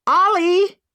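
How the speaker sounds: loud, high shout upward. Acted. Emma shouting up to Ollie, loud and high. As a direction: shout through the ceiling for help. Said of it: "Emma shouted".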